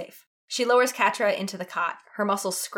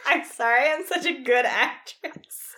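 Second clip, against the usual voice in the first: laughing; 7 semitones higher, 4 dB louder, slower than usual. Real, not acted. Laughing at own reading.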